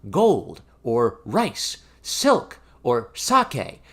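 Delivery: excited calling. A narrator's reading. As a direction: call out the wishes as you shake the mallet. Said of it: "at the same time calling out".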